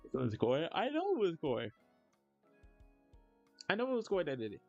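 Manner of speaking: ordinary talk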